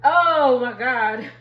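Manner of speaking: bursting into a loud laugh; a real laugh breaking out; loud laughing exclamation. Real, not acted. Laughing at the on-mute moment.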